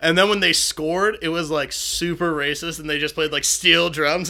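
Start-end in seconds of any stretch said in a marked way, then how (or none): none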